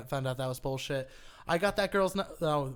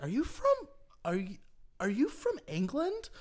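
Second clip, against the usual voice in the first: put-on higher-pitched voice of a curious girl; surprised girl; 8 semitones higher than usual. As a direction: imitate a girl asking, pitched up at the end. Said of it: "and she's like".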